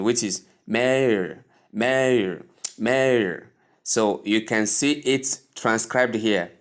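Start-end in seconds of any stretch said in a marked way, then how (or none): 0.7-3.5 mayor stretched slowly as MAY-er; American pronunciation. Shown as a demonstration. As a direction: say mayor the American way, "ay" then schwa and R. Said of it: "an alternative in American pronunciation".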